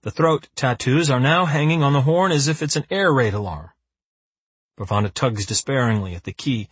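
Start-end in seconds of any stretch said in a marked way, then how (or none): none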